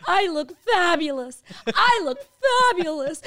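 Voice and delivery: high, loud self-pep talk; mock affirmation. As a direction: chant it to yourself in the mirror, way up high. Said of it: "I say three times".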